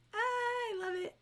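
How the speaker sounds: sudden high squeal of delight. Real, not acted. Host squealing with delight over a pun in the book.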